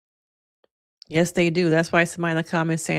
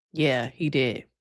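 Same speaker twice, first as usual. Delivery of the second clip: normal talking voice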